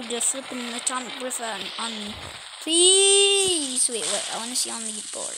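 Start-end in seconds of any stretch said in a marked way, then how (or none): 2.6-3.9 desperate plea. Real, not acted. Pleading as monster closes in.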